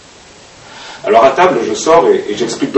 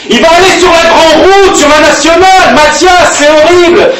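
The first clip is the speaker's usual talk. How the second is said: high, loud and frantic; panicked cry; 14 semitones higher, 5 dB louder than usual. Acted. Girl crying out to Mathias as the dog escapes, terrified. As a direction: shriek it in terror as the dog runs off.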